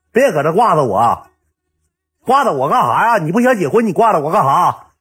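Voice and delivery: high, loud retold tirade; rising, high-pitched mock fury; angry outburst. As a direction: act out a fed-up girlfriend demanding answers. Girlfriend's furious outburst, acted out.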